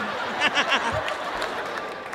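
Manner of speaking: breathy villain cackle; evil laugh. Retold or imitated. Dad gloating over son's fate, evil laugh. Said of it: "That evil laugh".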